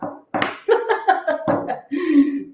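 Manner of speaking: short high laughter. Real, not acted. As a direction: break into a laugh. Risa tras exhortar. (risa aguda)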